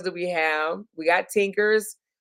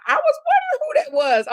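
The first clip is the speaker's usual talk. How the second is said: surprised realization; 3 dB louder than usual. Real, not acted. Recognizing a viewer's new name.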